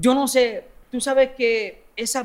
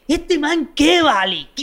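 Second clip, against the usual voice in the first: mocking scorn; 8 semitones higher, 4 dB louder, slower than usual. Cita burlona con risa.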